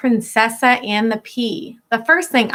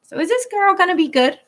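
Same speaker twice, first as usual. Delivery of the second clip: ordinary talk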